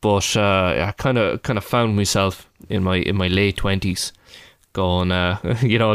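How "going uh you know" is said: ordinary talk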